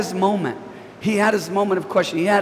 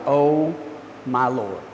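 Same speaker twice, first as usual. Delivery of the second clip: low exasperated groan of a phrase; frustrated sigh; 7 semitones lower, 3 dB quieter, slower than usual. Shown as a demonstration. Preacher acting fed up with someone, low weary oh my Lord. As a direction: sink the voice, fed up. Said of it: "you're frustrated with somebody".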